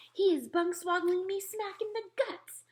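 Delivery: high, loud shout climbing upward; outraged shout. A narrator's reading. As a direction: shout the complaint at high pitch. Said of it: "shouted the gizzard gulper".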